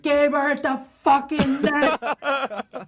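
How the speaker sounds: high, slow laughing yell across the ice; screaming from down the rink. Retold or imitated. Coach-like teammate screaming at him to hit the net, slow high yell. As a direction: bellow it down the rink, half laughing. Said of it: "just screaming at me".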